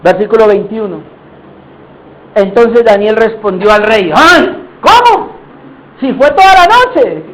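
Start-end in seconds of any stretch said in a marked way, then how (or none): 4.2-7.2 high, incredulous exclamation; astonished. Acted. Hearing Daniel answer after a whole night, astonished. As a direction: exclaim it in disbelief, pitch leaping up.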